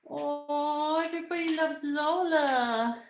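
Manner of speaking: high, drawn-out adoring coo; gushing pet talk. Real, not acted. Owner tells her dog everyone loves her, gushing. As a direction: gush loudly over a beloved dog.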